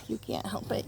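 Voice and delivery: ordinary talk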